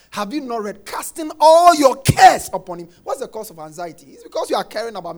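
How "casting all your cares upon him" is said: preaching shout. Real, not acted.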